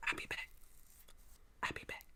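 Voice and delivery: whispered under the breath. Retold or imitated. Friend retelling Chris Tucker's exit, whispered aside. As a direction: slip it out in a whisper. Whispered quote while leaving.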